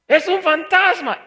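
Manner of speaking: high, loud panicked shout; terrified cry. Acted. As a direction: scream it like you've seen a ghost. Disciples cry out in fear.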